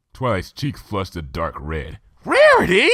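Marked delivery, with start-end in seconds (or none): 2.2-2.9 loud high scolding cry; hissed rebuke. Acted. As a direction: scold her friend sharply. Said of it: "she hissed".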